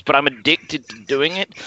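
normal talking voice

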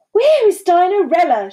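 high, loud demand; forceful demand. A narrator's reading. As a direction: demand an answer at once. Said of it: "she demanded".

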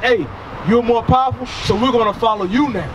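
ordinary delivery

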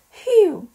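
very high, loud phew; exaggerated phew. Handler worn out from counting, a loud phew. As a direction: blow out a big tired breath.

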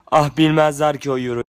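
loud, exasperated outburst. Acted. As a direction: burst out in frustration. (bıkkın bir çıkış)

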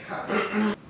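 ordinary talk

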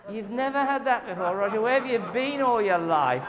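high, loud teasing local voice; northern locals teasing. Retold or imitated. Locals teasing the southern visitor, loud and high. Locals mocking his surprise.

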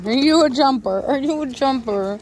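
cooing to a puppy; sing-song voice for the dog. Real, not acted. Baby talk to the dog.

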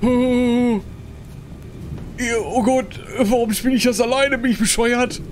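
high, nervous laughing panic; freaking out with a nervous laugh; scared horror game streamer. Real, not acted. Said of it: "oh Gott, ich will das nicht mehr spielen". (verängstigter Horror-Streamer)

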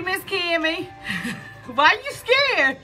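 ordinary talk

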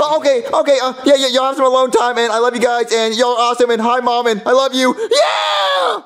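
put-on gushing kid Junior. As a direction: babble happily then whoop. Junior gushing, ends in cheer.

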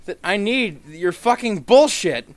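high, loud furious rant; frustrated gamer yelling at lag; angry rant. Real, not acted. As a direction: rage at the game out loud. Raging at laggy game.

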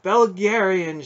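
slow, high, loud exultant call; ringing, drawn-out exultation; soaring exultation. A narrator's reading. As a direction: call the name in triumph. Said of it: "with a soaring exultation".

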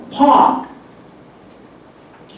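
「パー」と高く息を破裂させ、最後のTは消す; 英語式potのように語末を飲み込んで「パー」; 語末のTが聞こえない. Shown as a demonstration. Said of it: "聞こえない". (語末Tなしの英語式「パー」)

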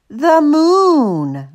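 high, drawn-out dreamy announcement; big, lingering proclamation; grand announcement. A narrator's reading. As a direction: announce it grandly, stretching MOON. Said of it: "Martha announced".

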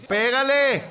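urging shout. Real, not acted. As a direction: yell it at the striker. Calling to player to shoot. (grito urgente al jugador)

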